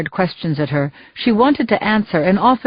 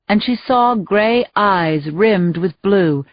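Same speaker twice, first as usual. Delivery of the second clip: ordinary delivery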